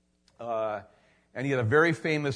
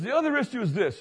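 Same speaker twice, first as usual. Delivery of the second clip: higher, strained retold voice; worried confession; 8 semitones higher, 4 dB louder, faster than usual. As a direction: admit it like it hurts. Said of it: "He goes".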